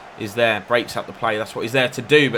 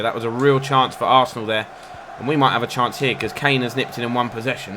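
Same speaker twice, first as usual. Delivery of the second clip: usual speaking voice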